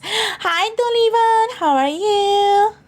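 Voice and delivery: high, drawn-out cutesy hello; sing-song high greeting. Acted. Greeting a chatter by name, sing-song and high. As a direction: coo the greeting up high and slow. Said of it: "why you say it like that?".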